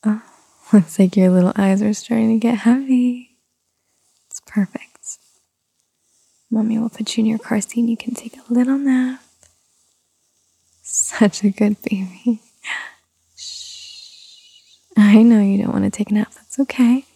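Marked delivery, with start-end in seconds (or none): 4.3-12.4 lulling whisper. Acted. 13.4-14.8 gentle hushing; soft shush. Acted.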